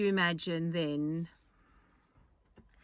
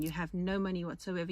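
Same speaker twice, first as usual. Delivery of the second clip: ordinary delivery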